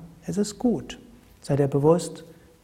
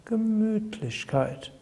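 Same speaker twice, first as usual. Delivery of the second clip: slow, soft cosy word; slow soothing Gemütlichkeit; 6 semitones higher, 4 dB quieter, slower than usual. Shown as a demonstration. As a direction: let it melt out slowly.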